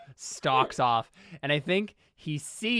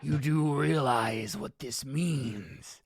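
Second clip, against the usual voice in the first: very slow, ominous delivery; menacing old man; much slower than usual. Acted. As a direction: let each word hang over the cornered boy. Ernest looms over Paul.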